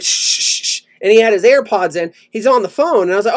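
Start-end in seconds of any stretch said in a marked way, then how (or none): 0.0-0.8 imitated repeated shushes; string of sharp voiceless shushes; shushing. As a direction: shush the passenger like the driver. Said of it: "he's like".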